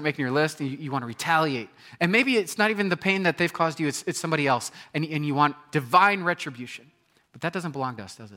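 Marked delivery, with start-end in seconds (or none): none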